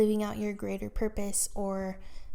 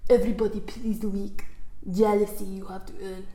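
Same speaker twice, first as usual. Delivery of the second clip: put-on a light Austrian accent, Arnold style; hint of an Austrian-accented action hero; Arnold Schwarzenegger accent. Reading a wall quote to listeners in light Austrian accent. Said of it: "I like reading this one in his accent".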